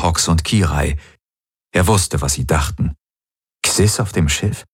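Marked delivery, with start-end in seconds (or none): none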